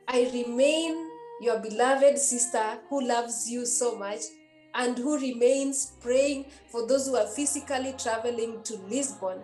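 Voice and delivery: usual speaking voice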